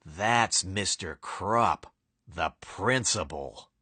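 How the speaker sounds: low, slow and soft, dark introduction; ominous reveal. A narrator's reading. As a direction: drop the voice to unveil the villain.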